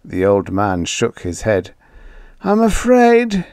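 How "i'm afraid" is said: high, slow frightened whimper; a scared old man's whimper. Acted. Ewing whimpering his fear to Joey. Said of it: "he whimpered".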